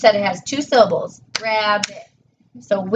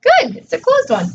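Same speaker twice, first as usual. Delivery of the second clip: bright praise. Real, not acted. Praising child's correct answer.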